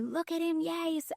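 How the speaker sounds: high, soft mock cheer; American-style overreacting. Speaker imitating Americans cheering someone on, overreacting. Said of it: "uncomfortable embracing of the overreacting".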